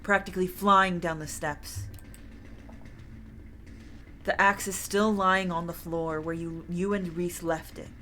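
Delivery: ordinary delivery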